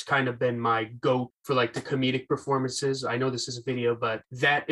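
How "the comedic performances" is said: normal talking voice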